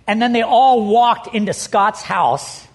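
ordinary talk

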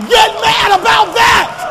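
high, loud pulpit shout; shouting preacher, pressing hard; preaching at full force. Acted. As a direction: call the church out with fire. Climax of preaching.

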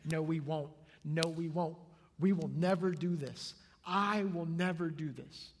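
loud, emphatic group denial; proud insistence. Acted. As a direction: protest firmly, building to a personal vow. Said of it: "it's all about pride and ego".

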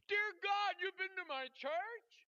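very high, loud astonished outburst; shrieked imitation of disbelief; going nuts. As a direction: exclaim it as the stunned nurse, stretched out. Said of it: "now she goes nuts".

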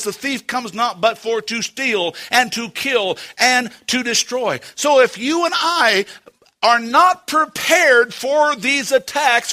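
emphatic, driving sermon voice; full-force preaching. Acted. Preacher warning church about Satan's attacks, forceful. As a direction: hammer the warning home from the pulpit.

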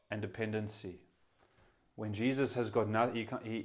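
ordinary delivery

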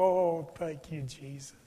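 a hint of a father breathing out his relief; softly grateful. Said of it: "arms and go".

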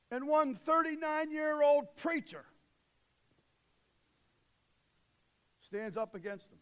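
loud, driving sermon delivery; booming pulpit voice; full-force preaching. Acted. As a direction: preach it to the back row.

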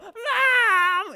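high, loud yell for mom; shouted call for mom. Retold or imitated. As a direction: holler for mom to come save you. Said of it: "then I would just yell".

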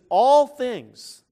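"All" punched high and very loud; stress on All. Real, not acted. Preacher repeating a verse to make his point, heavy stress on "All". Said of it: "I was emphasizing that word".

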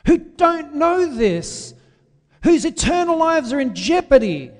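high, loud fiery sermon voice; preaching at full force. Acted. Preacher warning his congregation, full-force preaching. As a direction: thunder it out like a revival preacher. Preacher warns of Christians' fate.

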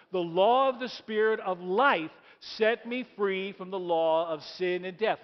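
loud, slow, emphatic recitation; booming reading that leads the whole congregation; read it like you mean it. Shown as a demonstration. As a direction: proclaim the verse, every word weighed. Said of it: "Read it like you mean it".

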